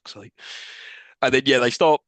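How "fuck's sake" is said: breathless whispered groan; exasperated whisper. Retold or imitated. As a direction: sigh it out under your breath. Reacting to the film, exasperated.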